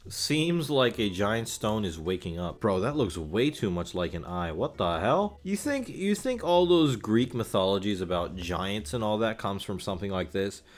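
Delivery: ordinary delivery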